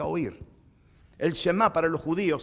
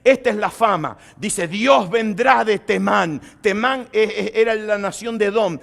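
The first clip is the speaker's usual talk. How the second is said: fervent, louder scripture reading; preaching reading; 5 semitones higher, 6 dB louder than usual. Acted. Preacher reads Habakkuk chapter three to the congregation, fervent. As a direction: proclaim the psalm with rising force.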